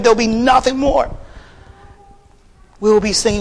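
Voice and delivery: high, driving sermon delivery; full-force preaching. Real, not acted. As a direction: proclaim it to the congregation with fervour.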